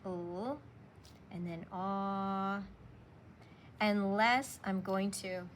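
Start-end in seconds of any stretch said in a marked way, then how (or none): 0.0-2.6 "aw" stretched out slowly; slow vowel sound. Shown as a demonstration. Teacher slowly stretches the "aw" vowel for students. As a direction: hold "aw" long and slow. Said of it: "So make the blend".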